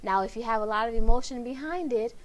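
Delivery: ordinary talk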